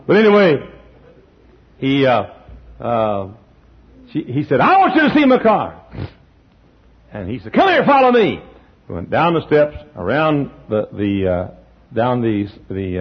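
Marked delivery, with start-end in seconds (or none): 4.6-5.8 much higher and louder, laughing through it; high excited call with a laugh; eager friend. Retold or imitated. Friend shows off his car. 7.5-8.4 much higher and louder beckoning; high eager call; eager friend. Retold or imitated. Friend leads him to the car.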